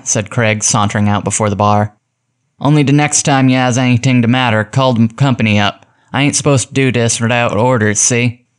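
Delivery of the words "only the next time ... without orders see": put-on workman's slang, a little raised; rough street-talking gasman; gruff gasman. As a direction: tell them off like a tough tradesman. Gasman disguise before the bar.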